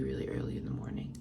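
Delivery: breathy early morning whisper. Real, not acted. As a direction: whisper it softly.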